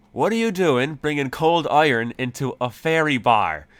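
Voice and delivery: loud, high, exasperated scolding; incredulous outburst at a blunder; annoyed leprechaun. Acted. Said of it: "looks absolutely annoyed at our presence".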